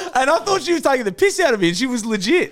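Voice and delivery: high-pitched talking through laughter; laughing. Real, not acted. Comedian explaining own punchline to co-hosts, really laughing. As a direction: crack up while telling it.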